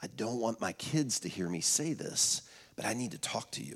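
retold hush, voice kept low and soft; imitated whisper. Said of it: "She whispered".

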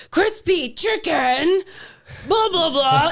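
slow, high, loud mock repeat; exaggerated exasperated repeated order; loud over-enunciation. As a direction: pronounce each word loudly for someone who misheard. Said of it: "It's very loud. You pronounce each word".

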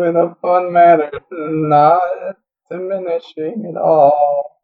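high, drawn-out mocking echo; sarcastic echo. Real, not acted. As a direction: echo the excuse with dry disbelief. Echoes player's excuse sarcastically.

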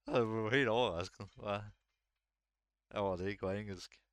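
usual speaking voice

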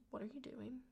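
hushed, startled mother's whisper; mom's hushed question. Retold or imitated. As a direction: breathe it like a shocked mom. Mom startled, quoted in story.

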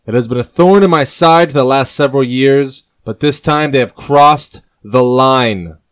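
the king's fury spilling over; angry king. Acted.

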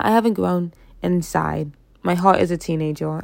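ordinary talk

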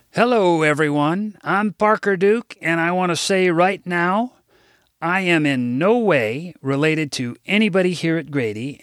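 formal speech. Acted. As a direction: speak up to a hall of kids, a touch showy. Gives a school assembly speech.

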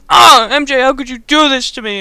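loud, high mock anguish; hammed-up betrayed cry; Harry. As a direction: wail the reproach as a melodramatic boyfriend. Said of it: "then Harry walks in".

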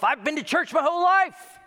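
hammed-up church kid bragging; mock churchgoer. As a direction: boast like a proud churchgoer. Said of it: "Don't say to yourself".

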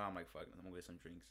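normal talking voice

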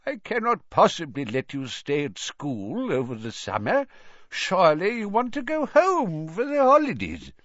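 put-on high, wavering elderly voice; kindly old headmaster. A narrator's reading. Old headmaster refuses the boy's request to stay over summer, kindly. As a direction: reason with a pupil as a frail old man.

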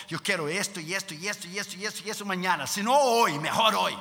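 rattled-off mock wish list building to an insistent finish; demanding believer. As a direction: mimic impatient praying. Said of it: "como tú y yo".

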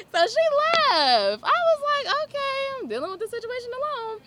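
high, loud words bursting through laughter; real laughing. Real, not acted. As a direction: laugh helplessly while telling it. Laughing over retold HR setback.

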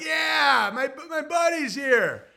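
high, loud, slow happy dog; excited dog voice. Retold or imitated. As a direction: play a thrilled dog at the truck. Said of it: "They're like".

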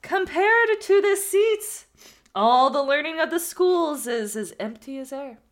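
slow, loud, high and grand proclamation; theatrical oracle. Acted. Man in the sack boasting to the student, theatrical and grand. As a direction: declaim it like a wise prophet. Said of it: "this needs to be really theatrical".

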